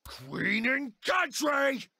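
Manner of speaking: slow, drawn-out proud shout; rallying cry. Acted. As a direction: stretch it like a battle cry. Patriotic battle cry.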